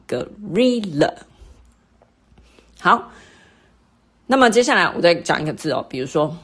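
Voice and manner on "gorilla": gorilla drawn out, RI lifted; stress on second syllable. Shown as a demonstration. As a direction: stretch gorilla and raise the middle syllable. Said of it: "在第二个re的那个地方".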